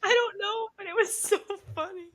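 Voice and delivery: high-pitched, helpless amusement; real laughing fit. Real, not acted. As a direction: talk through the laughter. Cracking up over witch joke.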